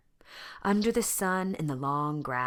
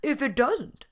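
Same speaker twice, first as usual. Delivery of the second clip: lion wondering; 10 semitones higher, 7 dB louder than usual. A narrator's reading. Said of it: "said Leonard".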